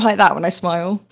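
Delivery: ordinary talk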